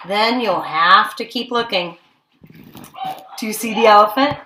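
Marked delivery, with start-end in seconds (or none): none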